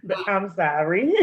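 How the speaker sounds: giggling sorry, then a high laugh; laughing apology. Real, not acted.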